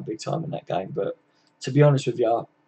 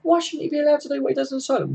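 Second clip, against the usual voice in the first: high, fast, outraged comeback; high-pitched, rapid, indignant mock of the moaning managers; indignant retort; 12 semitones higher, faster than usual. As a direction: fire it back in a much higher mocking voice. Mocking retort to other managers.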